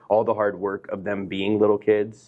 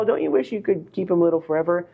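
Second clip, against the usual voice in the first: higher, gushing sing-song parody; mocking other parents; 9 semitones higher than usual. Mocking the nostalgic parents, higher gushing imitation. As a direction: mimic the sweet nostalgic question. Mocking what everybody says.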